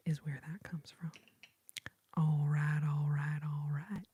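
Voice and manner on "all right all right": normal talking voice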